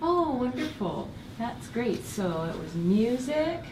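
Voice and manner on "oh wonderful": usual speaking voice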